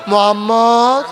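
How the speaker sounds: mocking call. Unbelievers taunting the Prophet with a loud, high call. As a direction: call him out across the crowd.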